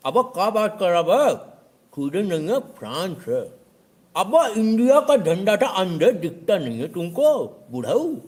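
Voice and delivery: know-it-all viewer jeering at the host, sneering; mocking commenter. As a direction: jeer at the old man.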